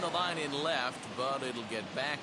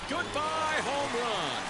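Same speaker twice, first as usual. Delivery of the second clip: high, ringing play-by-play cry; big sportscaster home run call; excited home run call; 11 semitones higher, 3 dB louder, slower than usual. Acted. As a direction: call the homer as it sails out, pitch soaring then falling. Calling a home run live.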